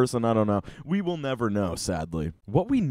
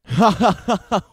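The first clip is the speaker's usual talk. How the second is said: loud laugh; 8 semitones higher, 7 dB louder than usual. Real, not acted. Cracks up after reading.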